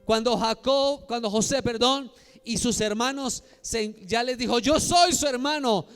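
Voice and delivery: high, loud dramatic sermon delivery; impassioned preaching. Real, not acted. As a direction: proclaim the emotional reunion to the crowd. Preaches Bible reunions at full force. (prédica apasionada, a viva voz)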